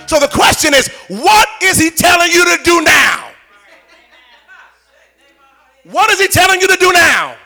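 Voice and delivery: loud, driving preacher's shout; full-force preaching. Acted. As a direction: preach it home to the congregation.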